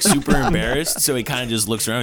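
ordinary delivery

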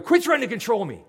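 loud, high snap of resentment; angry outburst. Retold or imitated. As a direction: shout it back defensively. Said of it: "And we're going".

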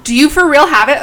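high, loud disbelieving question; excited. Real, not acted. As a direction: burst out in thrilled disbelief. Friend reveals the jelly beans.

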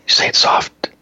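voiceless whispered chant; whispered slogan. Pretend. Host twisting a slogan for whisperers, whispered. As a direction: whisper it like a rallying cry.

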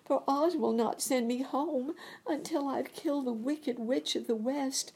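put-on high, young girl's plea; lifted girlish voice; Dorothy's voice. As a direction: voice the little girl near tears. Dorothy speaks, quoted in the story.

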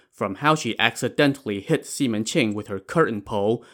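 ordinary delivery, nothing marked

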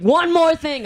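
high, loud mock warning; retold losing it. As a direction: replay an angry final warning. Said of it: "I, like, lost my shit".

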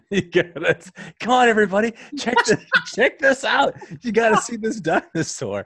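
high, loud calling out through laughter; laughing mock call-out. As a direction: summon the whole staff while cracking up. Acting doctor calling staff over.

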